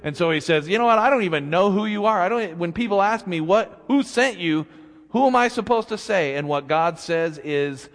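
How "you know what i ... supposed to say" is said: flustered protest. Acted. Moses protesting to God.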